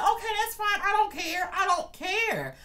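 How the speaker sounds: loud, sky-high defiant retort; high-pitched outburst. Retold or imitated. Worker answering his boss back, high loud mock defiance. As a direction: act out defiance way up high, dragging the last words. Acted retort to being called worthless.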